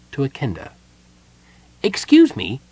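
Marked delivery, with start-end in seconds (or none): 1.8-2.6 high-pitched, louder polite kender voice. A narrator's reading. Kender interrupting the gnome at his work, polite. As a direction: pipe up courteously in a high voice. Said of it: "said the kenda politely".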